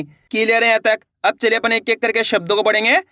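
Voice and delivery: usual speaking voice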